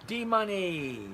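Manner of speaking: high, drawn-out nickname call; playful hail. Real, not acted. Host turning to a viewer's question, playful calling out. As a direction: stretch the nickname out, pitched high.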